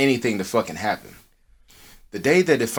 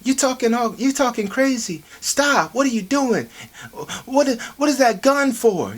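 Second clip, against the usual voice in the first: high alarmed woman; 12 semitones higher than usual. Retold or imitated. Great granny confronting armed man.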